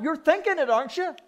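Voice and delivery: usual speaking voice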